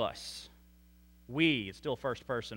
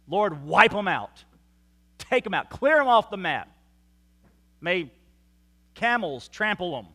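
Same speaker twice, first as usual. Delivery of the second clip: mock vengeful plea; 3 semitones higher, 6 dB louder than usual. Imagined vengeful prayer, hyperbole.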